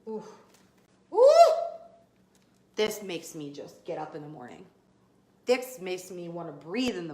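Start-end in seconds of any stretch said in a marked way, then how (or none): 1.1-1.5 high loud ooh, bursting with excitement; excited whoop. Real, not acted. Coach to viewers, a thrilled squeal. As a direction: let out a sudden thrilled squeal. Excited yelp before next point.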